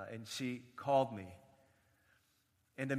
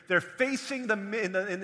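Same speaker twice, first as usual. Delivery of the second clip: usual speaking voice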